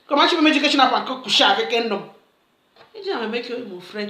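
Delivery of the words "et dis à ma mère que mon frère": normal talking voice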